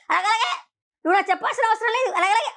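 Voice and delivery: high, quick brush-off; mimicked dismissive retort. Mimicking Madhuri brushing off advice, dismissive retort. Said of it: "ఆ మాట తీరుంది చూసావా".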